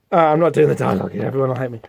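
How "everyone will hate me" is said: normal talking voice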